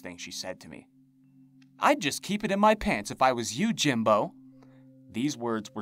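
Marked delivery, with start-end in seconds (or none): 1.8-4.3 high, louder sneering crone voice; menacing hag. A narrator's reading. Creepy hag threatening the narrator, sneering. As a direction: taunt him like a monster crone.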